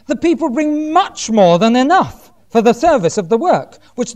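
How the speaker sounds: projected, declaimed Bible reading; scripture reading. Acted. Preacher reading Moses' command to Israel, declaimed. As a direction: proclaim the passage to the congregation.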